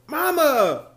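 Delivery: high, loud shocked protest; a son crying out at his mother's insult; outraged cry. Acted. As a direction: cry out at her in disbelief.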